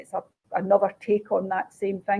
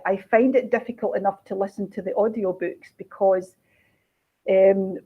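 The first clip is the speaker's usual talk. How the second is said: ordinary talk, nothing marked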